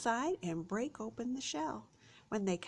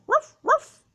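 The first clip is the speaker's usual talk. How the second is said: high, loud barking; dog bark; 15 dB louder than usual. Shown as a demonstration. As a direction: bark like a playful pup. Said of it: "The dog is still there".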